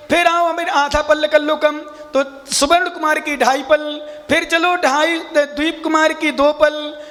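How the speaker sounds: ordinary talk, nothing marked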